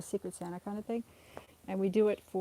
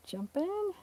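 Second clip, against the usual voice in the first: pet talk voice; 10 semitones higher than usual. Real, not acted. Coaxing the cat.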